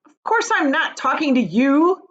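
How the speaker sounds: loud, high, irritated retort; cross and impatient. A narrator's reading.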